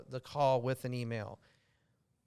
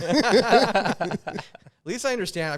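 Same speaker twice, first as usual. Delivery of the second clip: laughing; 7 semitones higher, 10 dB louder, much slower than usual. Real, not acted. Laughing reply.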